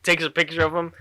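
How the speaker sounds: usual speaking voice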